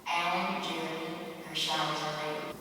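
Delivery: lightly imitated PA announcer, soft; PA announcement. Truck stop PA calls drivers to showers, lightly imitated announcer. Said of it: "is a voice that says".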